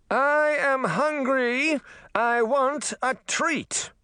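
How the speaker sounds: very high, drawn-out royal demand; demanding princess. A narrator's reading. Hungry mermaid princess to her servants, high-pitched demand. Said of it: "she demanded".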